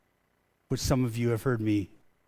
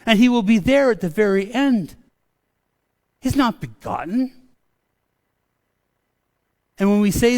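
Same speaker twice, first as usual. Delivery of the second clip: louder, higher preaching rant; rising rant; 7 semitones higher, 6 dB louder than usual. Real, not acted. Pastor ranting to the congregation about begotten, louder and higher. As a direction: build it up, preaching with fire. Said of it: "this is where my rant starts".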